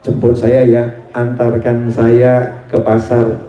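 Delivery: low, unhurried request voice; calling kiai. Retold or imitated. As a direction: ask like a dignified elder on the phone. Kiai's phone request to be fetched.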